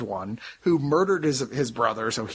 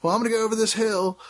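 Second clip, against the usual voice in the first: cheerful Scot heading off; 8 semitones higher, faster than usual. Retold or imitated. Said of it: "Everyone's like".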